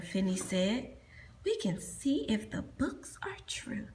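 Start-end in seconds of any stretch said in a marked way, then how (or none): 1.4-3.9 unvoiced and hushed; whispered plan. Finny whispering a plan to Crag. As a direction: whisper the secret idea.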